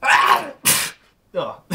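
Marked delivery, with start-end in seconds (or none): 0.0-0.5 sneeze build-up. Real, not acted.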